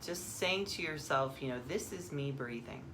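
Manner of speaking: calm meditation guide, long pauses; guided meditation. Acted. Guide turns listeners inward to the breath, calm meditation voice. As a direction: ease them into stillness, phrase by phrase.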